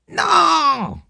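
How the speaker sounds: cri très aigu, plein de panique; cri paniqué suraigu. Acted. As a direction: crie de terreur. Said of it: "d'une voix suraiguë où perçait la panique". (De Pape supplie, cri suraigu et paniqué)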